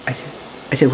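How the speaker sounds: normal talking voice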